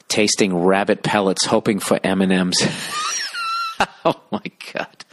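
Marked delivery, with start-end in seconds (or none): 2.7-4.0 cracking up in a high-pitched squeal; high wheezing laugh. Real, not acted. Cracking up at the story. 4.0-5.0 voiceless wheeze after cracking up; breathless from laughing. Real, not acted. Still cracking up after the story.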